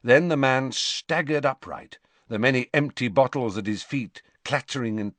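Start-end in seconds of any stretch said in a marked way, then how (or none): none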